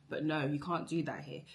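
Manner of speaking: ordinary talk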